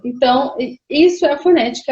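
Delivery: usual speaking voice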